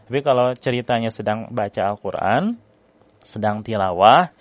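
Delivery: normal talking voice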